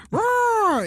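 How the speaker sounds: high mock macaw screech; hammed-up bird roar. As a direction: squawk like a big parrot. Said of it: "the macaw sometimes would go".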